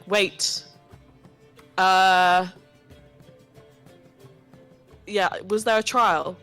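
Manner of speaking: loud high call to halt it; shout. Acted. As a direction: cut through the crowd with a loud call. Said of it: "kinda shout".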